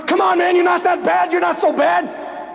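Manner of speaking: mock your opponent with a grin; Rocky taunting Clubber Lang. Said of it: "He says".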